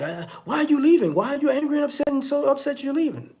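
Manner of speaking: high-pitched, insistent mock nagging; pestering. Follower hounds the person who is leaving, high mock pestering.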